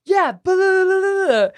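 high, sustained "blah blah" jeer; shrieked babble, retold as a taunt; mocking taunt. Acting out her taunt, backed by crowd.

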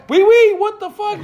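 very high, loud, laughing squeal; high-pitched comic freak-out; mock French outburst. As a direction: blurt out French in hammed-up disbelief. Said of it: "I'm fucking speaking French".

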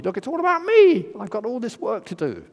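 high, loud exasperated whine; self-pitying complaint. Retold or imitated. Martha complaining about the work, high loud exasperated whine. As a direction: moan that it's all on you. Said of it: "what she says".

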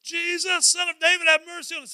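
high, loud urgent cry; calling out. Acted. Blind men begging Jesus to notice them, calling out. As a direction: shout the plea to get his attention. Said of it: "They call out to him".

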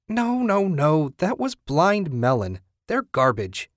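high, scoffing no no no; music snob correcting her friend; indignant correction. A narrator's reading. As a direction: wave off the wrong band in disgust. Roommate dismisses wrong band.

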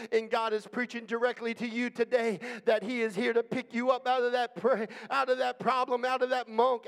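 ordinary talk, nothing marked

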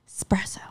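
"espresso" clipped to a whispery "spresso"; shortened pronunciation. Shown as a demonstration. Friend demonstrating the shortened espresso, whispered "spresso". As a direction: drop the first vowel of "espresso", whispering. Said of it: "You were, like, shortening it".

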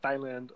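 "Thailand" mimicked with a soft th, as "thigh-land"; "Thailand" begun like the word "thigh"; mimicked mispronunciation. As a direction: mockingly echo "Thailand" as "THIGH-land", th as in thin. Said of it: "really said it like that".

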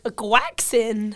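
drawn-out "quaxin", KWAK-sin; slow mangled croissant, "quaxin"; Tom Holland. Retold or imitated. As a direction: say "quaxin" slowly for croissant, hard kwack. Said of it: "in a few interviews, he's like".

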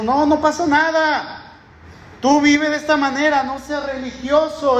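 voz más aguda, tono de quitarle importancia; voz burlona del mundo. Retold or imitated. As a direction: imita al mundo que tienta con despreocupación. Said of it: "Ellos están diciendo". (pastor imita con voz aguda al mundo tentador)

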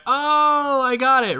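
long high "oh" of sudden understanding; mock realization. Guards finally understanding agua, hammed-up realization.

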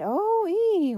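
very high, loud drawn-out hoot; long high echo of a holler; echoing call. A narrator's reading. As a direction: sing it out like a returning echo. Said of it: "echoed back through the silent room".

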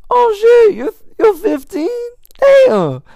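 high, loud mock shock at being fifteen; squeaky surprised outburst; shocked stranger. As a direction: play the man's jaw-drop disbelief. Stranger shocked at his age.

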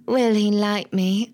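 slow, lightly imitated film-star ingenue; a hint of a wistful starlet, drawn out; Audrey Hepburn. Host mocking a lovesick heroine, lightly imitated starlet. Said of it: "like Audrey Hepburn being like".

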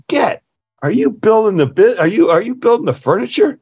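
sudden high alarmed asking; alarmed question. Retold or imitated. Shop teacher fearing his poor student builds furniture, alarmed. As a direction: ask in rising dread. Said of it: "he looks at me and says".

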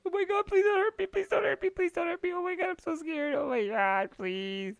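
very high, fast, squeaky hammed-up begging; mock terrified Seth. Seth cowering from The Fiend, squealing hammed-up terror. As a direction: whine it like a cowering coward, way up high. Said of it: "He's like".

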